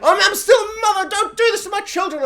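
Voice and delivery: high, loud protective cry; desperate shout. Retold or imitated. Mother defying grandmother for her children, desperate shout. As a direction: shout to save your children. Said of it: "mother's like".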